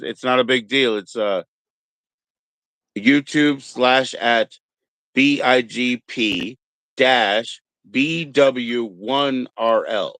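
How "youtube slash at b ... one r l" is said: spelling the handle out slowly, letter by letter; slow down so the viewers can type it; slow dictation. Shown as a demonstration. Host reading his channel handle to viewers, slow and deliberate. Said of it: "That's all you gotta know".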